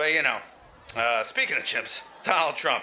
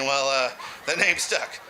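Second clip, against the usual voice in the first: normal talking voice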